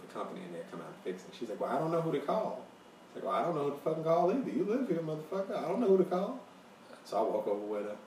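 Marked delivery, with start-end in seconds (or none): 1.6-2.6 higher-pitched imitation of the lady; retold lady. Retelling the lady's helpless reply. 3.2-6.4 higher-pitched exasperated mimicry; mock exasperation. His exasperated retort mimicking her.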